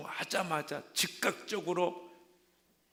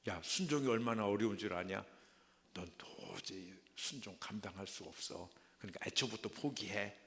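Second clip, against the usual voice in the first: tempting; 4 semitones lower, 6 dB quieter than usual. Acted. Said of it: "이렇게 유혹을 하는 것입니다".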